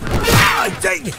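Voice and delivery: sudden loud yelp, then dang it; pained shout. Acted. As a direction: shout it like you just got hit. Gets punched in the game.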